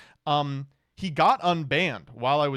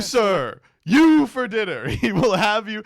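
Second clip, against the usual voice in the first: loud, higher and slowed Trump parrot; hammed-up Trump echo; 7 semitones higher, 8 dB louder than usual. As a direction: boom the dinner line back at him, laughing. Echoing Trump's dinner line.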